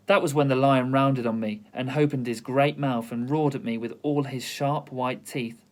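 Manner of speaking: ordinary delivery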